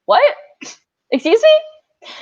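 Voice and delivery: high, loud incredulous excuse me; a sharp, stunned retold excuse me; shocked disbelief. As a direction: blurt it out in disbelief. Stunned at being disqualified.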